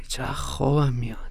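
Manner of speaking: sleepy whisper. Acted.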